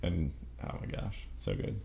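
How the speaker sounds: breathy hushed gush; whispered awe. Real, not acted. Gushing about the music.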